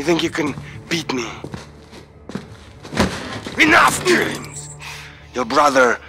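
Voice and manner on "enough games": high, slow angry exclamation; impatient outburst. Acted. Interrogator tired of captive's games, angry outburst. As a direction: draw the words out in exasperation.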